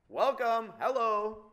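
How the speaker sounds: big comic welcome; mock cheery greeting. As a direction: announce a surprise arrival. Mock greeting from the unborn baby.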